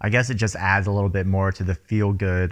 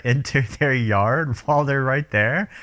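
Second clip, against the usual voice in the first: ordinary talk, nothing marked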